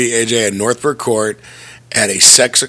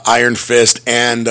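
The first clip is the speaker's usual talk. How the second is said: ordinary talk, nothing marked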